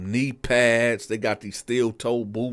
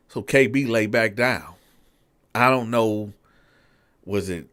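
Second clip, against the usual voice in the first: usual speaking voice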